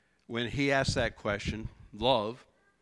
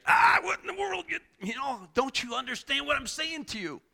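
exasperated outburst; 11 semitones higher than usual. Pretend. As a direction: blow up in frustration at a friend. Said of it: "He didn't freak out on Peter".

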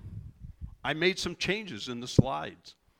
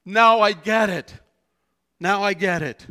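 higher, much louder, eager cry; excited realization; 6 semitones higher, 11 dB louder than usual. Retold or imitated. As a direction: burst out with sudden understanding. Peter's realization voiced.

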